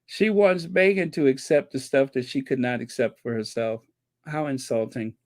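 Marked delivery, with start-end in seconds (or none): none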